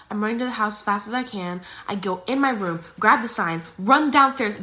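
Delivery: ordinary delivery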